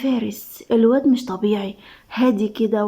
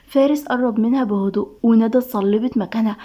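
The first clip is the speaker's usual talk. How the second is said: ordinary delivery, nothing marked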